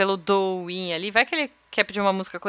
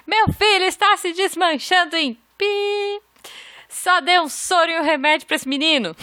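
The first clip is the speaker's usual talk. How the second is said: mother yelling; 8 semitones higher than usual. Retold or imitated. Reading a listener's story, mother yells at the nurse, mock yell. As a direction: play the furious mum in triage, half laughing. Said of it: "grita dentro da sala".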